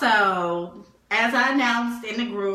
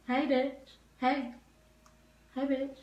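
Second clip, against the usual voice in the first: casual insulting hello; 5 semitones higher, 7 dB quieter than usual. Shown as a demonstration. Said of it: "How you say hello?".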